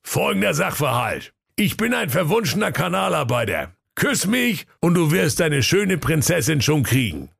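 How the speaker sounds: high, mocking workman voice; cheeky workman, teasing him; cheeky sewer worker. (frecher Kanalarbeiter, spöttisch)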